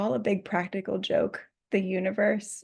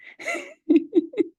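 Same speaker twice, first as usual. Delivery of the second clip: bright real burst of laughter; high giggle; 3 dB louder than usual. Real, not acted. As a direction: laugh at your own slip. Laughs at her mistake.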